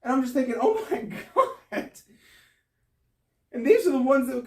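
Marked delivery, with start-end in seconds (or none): 0.6-1.9 high, startled gasp of disbelief with a laugh; stunned, half-laughing exclamation; shocked oh my god. Retold or imitated. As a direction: pitch jumps up in laughing disbelief. Shocked at lights left on.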